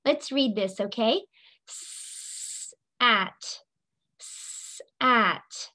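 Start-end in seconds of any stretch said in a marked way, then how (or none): 1.7-2.7 long hissing s sound; drawn-out s like a snake. Shown as a demonstration. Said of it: "It even looks like a snake". 4.2-4.8 long hissing s sound; drawn-out s like a snake. Shown as a demonstration. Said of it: "It even looks like a snake".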